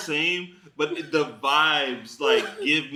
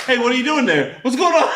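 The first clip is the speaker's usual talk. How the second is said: high, alarmed and half laughing; mock freak-out; 8 semitones higher, 4 dB louder, faster than usual. Host spots someone misbehaving, laughing mock alarm. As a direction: freak out, hammed up through laughter. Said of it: "And he freaks out".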